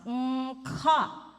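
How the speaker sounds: hum, then punch out "ka"; vocal exercise sound; demonstrated "mm-ka". Shown as a demonstration. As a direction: hum bursting into a loud "ka". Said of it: "go to the ka sound".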